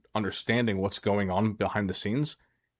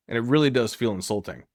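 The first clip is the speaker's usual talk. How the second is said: ordinary delivery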